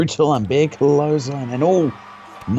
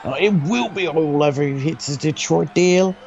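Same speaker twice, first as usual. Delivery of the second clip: wrestling commentator; 2 semitones higher than usual. Acted. Live wrestling play-by-play.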